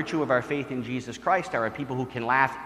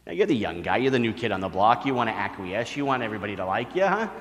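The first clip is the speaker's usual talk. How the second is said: normal talking voice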